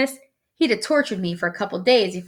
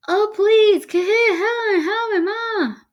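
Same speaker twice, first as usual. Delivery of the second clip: high muffled pleading wail, starting loud; mouth stuck mumble; 13 semitones higher, 3 dB louder, slower than usual. A narrator's reading. Stuck boy begs his brother for help, muffled wail. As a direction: plead desperately with your lips stuck. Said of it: "sounding like he had something in his mouth".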